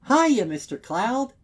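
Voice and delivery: high, loud, forced-bright shopkeeper voice; squeaky chipper hello, strained underneath; stiff cheery greeting. A narrator's reading. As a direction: greet him with a big fake smile in your voice. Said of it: "she says with a stiff wave".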